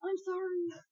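high, soft imitated apology; mock meek apology. To a crying sister, meekly apologizing.

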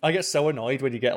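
normal talking voice

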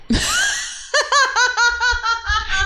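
long, high-pitched cackle; sustained shrieky laugh; hysterical cackle. Pretend. Reaction to nickname Shrimp Boy.

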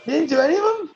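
high disbelieving question; real surprise, pitch jumps up; surprised. Real, not acted. Shocked by his correction.

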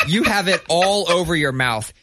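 breaking into real laughter mid-sentence; laughing through it. Real, not acted. Telling a friend how the kid kept missing the icing, laughing. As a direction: scold him but can't stop laughing.

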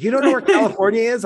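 high, fast disbelieving question; the boss can't believe it; incredulous boss. Retold or imitated. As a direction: boss can't believe she doesn't know where California is. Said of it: "he's like".

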